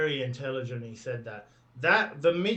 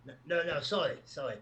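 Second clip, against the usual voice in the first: ordinary talk, nothing marked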